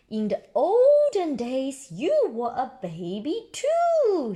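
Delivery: voiced Susie.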